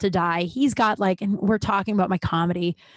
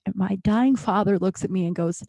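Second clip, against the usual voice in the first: ordinary delivery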